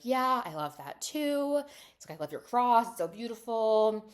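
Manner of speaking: high, loud gushing reply; mock lovestruck enthusiasm. Voicing smitten Mary's gushing reply.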